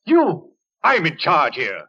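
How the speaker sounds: high, loud and scornful; mad doctor rage. Acted. Kettler raging at Winton's defiance, high and loud. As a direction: explode in arrogant fury.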